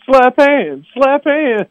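put-on high goofy Water Boy voice; Adam Sandler impression. To the crowd, high goofy Adam Sandler Water Boy voice. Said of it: "when Adam Sandler's going".

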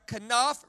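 Kanaf said ka-NAF, rising at the end; kanaf demo. Shown as a demonstration. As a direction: model Kanaf for the crowd to echo. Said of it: "This is how it sounds".

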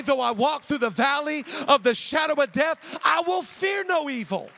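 high preaching building to full force; impassioned preaching. Acted. Preacher encouraging the congregation through trials, impassioned and building. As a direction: build from firm encouragement to a loud declaration.

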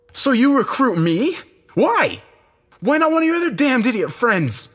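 loud, high, outraged demand; angry questioning. A narrator's reading. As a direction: confront him at full heat. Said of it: "I asked angrily".

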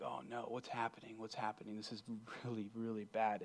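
very soft, murmured worry; hushed dismay. Acted. Rebel just sentenced to death, hushed dismay. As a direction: play quiet alarm, almost under your breath.

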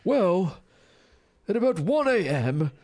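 high, plummy upper-crust English voice; upper-crust British accent. Acted. Host reads a teen raver's own account, hammed-up upper-crust accent. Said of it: "like, an upper crust, uh, type of accent".

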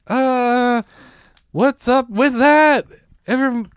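high, drawn-out hacky comic delivery; floundering comic. Retold or imitated. Comic retelling his floundering stage moment, floundering and drawn out.

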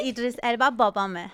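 "Idris Elba babame" in native Persian; "Idris Elba babame" with Persian vowels; Farsi. Shown as a demonstration. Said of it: "I say it in Farsi".

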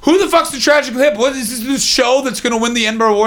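high, rushed imitation of screaming; mock screaming. Comic imitates his deaf buddy barging into a show, mock screaming. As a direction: pitch it way up like someone yelling unaware. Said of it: "he starts screaming".

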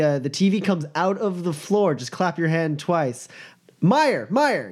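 high, singsong call sliding into breezy hosting; showy host, schmoozing. Acted. Boss ushers ladies to the bar, showy and high.

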